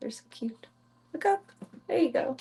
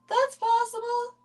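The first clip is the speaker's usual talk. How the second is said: much higher, slow mock squeak; squeaky high repeat; 13 semitones higher than usual. Shown as a demonstration. Owner repeating herself in a slow high squeak. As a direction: echo it in a tiny squeak. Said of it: "She thinks you're caterwauling".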